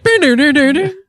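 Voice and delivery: high sung-out electronic chime; high bing and doo-doo jingle noise; Star Tours chime imitation. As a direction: imitate a theme-park chime, high pitched. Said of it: "I heard that sound".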